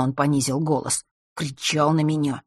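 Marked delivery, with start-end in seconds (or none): none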